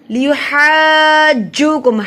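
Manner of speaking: "لیحاجوکم" with "haa" held very long on one note; long madd. Shown as a demonstration. Teacher showing three-alif length, "لیحاجوکم" hugely elongated. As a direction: stretch the haa, then land on the doubled j in "لیحاجوکم". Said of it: "تین الف کے برابر لمبا کیا جائے گا".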